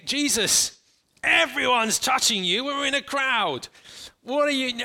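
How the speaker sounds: they're jostled and baffled; exasperated disciples. Acted. Disciples protest in the crowd.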